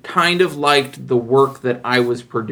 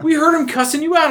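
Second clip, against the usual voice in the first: much higher, incredulous; stunned coworkers; faster than usual. Retold or imitated. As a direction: coworkers who overheard the yelling. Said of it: "And they're like".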